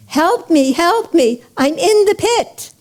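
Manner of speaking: high, urgent plea, rising in pitch; a storyteller's pressing, high-pitched cry; urgent cry for help. A narrator's reading. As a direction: call out urgently from the pit, without really shouting. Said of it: "calls out with more urgency".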